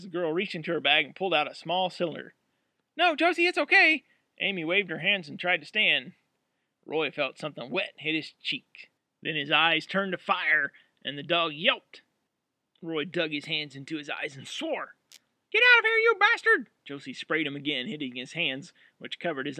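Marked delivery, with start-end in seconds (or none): 3.0-4.0 urgent call. Acted. Stopping roommate's attack. 15.5-16.7 furious shout. Acted. Roommate pepper-spraying Roy.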